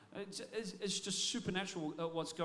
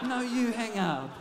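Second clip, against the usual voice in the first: slow, louder, giggly "No, you hang up"; lovestruck suitor; 7 dB louder, slower than usual. Retold or imitated. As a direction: coo it laughing like a smitten teen on the phone. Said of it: "wooing the woman they love".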